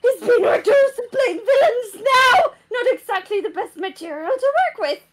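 high, loud reminiscing yell; shouting old woman guard. Acted. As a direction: boast about the old studio at the top of her lungs. The all-out shouting.

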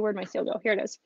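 unvoiced whisper to herself while searching; a quick breathy aside, half to herself. Real, not acted. Muttering aside while searching for seal.

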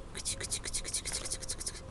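soft whispered cuți cuți to lure the cat; whispered cat call. Real, not acted. Parent coaxing the shy cat Rolly, whispering. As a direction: whisper it so the cat isn't scared.

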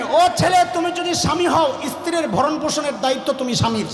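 preacher calling out to the men; fiery preaching. Acted. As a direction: proclaim it to the crowd. Preaching at full force.

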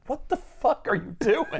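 high, slow, stretched-out astonishment; mock disbelief. Onlookers to woman catching litter off high-rise, mock disbelief. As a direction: draw out each word in hammed-up shock. Acting out the room's shocked reaction.

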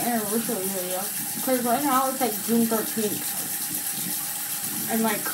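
talking while brushing teeth; toothbrush in mouth. Real, not acted. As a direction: mouth full of toothpaste foam. Seen 1: hand and toothbrush at mouth throughout, brushing with foam.

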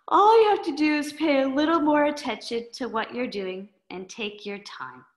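put-on a sleepy girl asking what's wrong; lightly voiced sleepy girl; sleepy Schluffy Girl.

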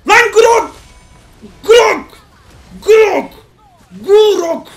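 loud high yells of a name; yelling at teammate Grok; shouting a name. Real, not acted. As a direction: call out sharply across the game.

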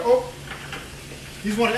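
ordinary delivery

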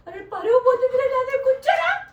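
loud, high plea; exasperated pleading. Real, not acted. Owner begs dog not to shame them, exasperated pleading. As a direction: beg loudly in mock despair.

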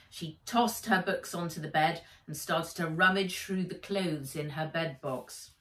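usual speaking voice

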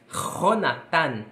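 "Jonathan" com J gutural, som de R forte; Jonathan em espanhol. Shown as a demonstration. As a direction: comece "Jonathan" raspando a garganta. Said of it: "Em espanhol se diz". ("Jonathan" dito à espanhola, J aspirado)

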